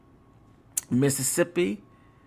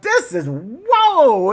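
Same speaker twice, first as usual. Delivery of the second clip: mock amazement; 7 semitones higher, 6 dB louder, much slower than usual. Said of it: "I'm like".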